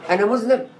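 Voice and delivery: أنا مذنب said as an incredulous question, pitch rising; mock disbelief, turning the admission into a denial. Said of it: "بس قالها بطريقة مختلفة". ("أنا مذنب" بنبرة استفهام إنكاري)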